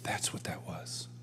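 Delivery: breathy whispered realization, slow. Retold or imitated. Realizing the proposal echoed the Lord, whispered. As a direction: whisper it as the penny drops. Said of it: "I went".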